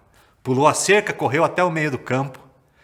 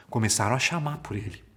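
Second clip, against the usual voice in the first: usual speaking voice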